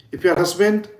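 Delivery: ordinary talk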